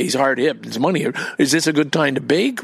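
ordinary delivery

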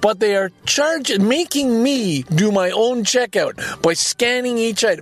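slow, high-pitched exasperated rant; pissed off. Real, not acted. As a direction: stretch the key words in real indignation. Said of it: "I'm just so pissed at that".

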